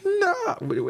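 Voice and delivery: high, crying mock wail; hammed-up high cry of no. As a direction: wail it like a movie scream. Mimicking Sonya's delayed scream.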